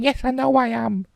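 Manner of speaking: lightly voiced chirpy woman; high female voice. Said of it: "She's saying".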